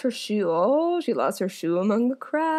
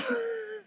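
sudden laugh; 14 semitones higher, 9 dB quieter than usual. Real, not acted. Bursts out laughing at the plot.

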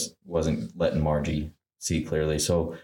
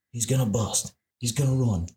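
put-on Robbie's accent, pitched higher; quick mimicked warning in an accent; imitated accent; 6 semitones higher than usual. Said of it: "Great accent".

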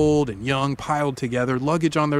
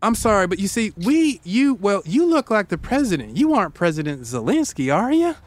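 flustered Southern politeness; 5 semitones higher than usual. Retold or imitated. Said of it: "You're like".

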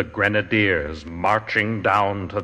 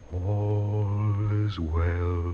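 eerie whisper; 3 semitones higher, much slower than usual. A narrator's reading. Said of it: "seeming to whisper".

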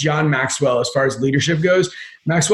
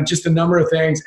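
ordinary talk

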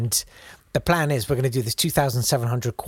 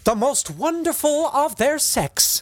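hammy stage actor; 11 semitones higher, slower than usual. Retold or imitated. Quoted praise.

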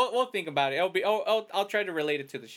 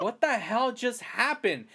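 higher and slow, dumbfounded; retold bewilderment; 7 semitones higher, slower than usual. Kid just walked off, dumbfounded and slow. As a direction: let the confusion hang. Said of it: "I was like".

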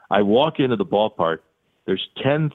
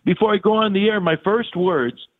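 mock panic; 12 semitones higher than usual. Panicking before broadcast.

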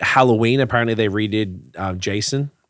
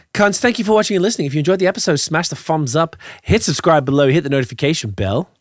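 upbeat sign-off, higher pitch; podcast outro; 5 semitones higher than usual. Acted. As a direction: hype up the listeners.

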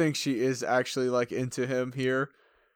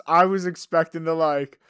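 ordinary talk

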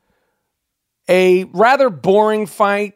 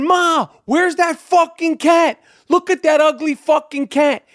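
mock heckler; 9 semitones higher than usual. Rowdy fan taunting him in the arena, mock jeer. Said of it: "they're going".

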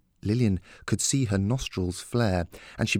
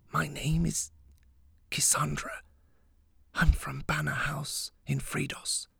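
soft whisper, higher and slower; 6 semitones higher, 6 dB quieter than usual. A narrator's reading. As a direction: whisper it close to his ear. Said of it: "she whispered to Attercop".

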